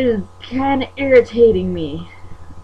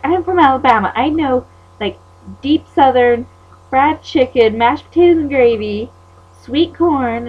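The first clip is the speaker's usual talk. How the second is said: louder, higher mimicked boast; mocking imitation; 6 semitones higher, 6 dB louder than usual. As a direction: mimic a rival's bragging, pitched up.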